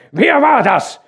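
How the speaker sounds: loud, high accusing yell; angry shout. A narrator's reading. Hauke angrily shouting at the workers. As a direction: demand the culprit. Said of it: "schrie er".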